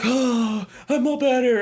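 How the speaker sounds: high-pitched mock tearful relief; mock crying. Freshly exorcised girl to her rescuers, mock crying relief. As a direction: imitate a girl crying that she's cured. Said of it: "she's, like, crying".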